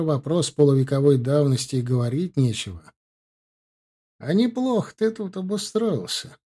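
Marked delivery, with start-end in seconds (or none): 4.2-6.4 drawled. A narrator's reading. Bazhen walks into his friend's basement lab, drawling. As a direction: draw it out, pitched well up. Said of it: "протянул заходящий в подвал Бажен".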